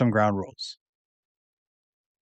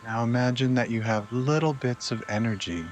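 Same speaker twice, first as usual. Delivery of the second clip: unhurried, lower and quieter, easing them into relaxation; guided meditation; 3 semitones lower, 7 dB quieter than usual. Acted. Host guides listeners to relax before sleep, guided meditation.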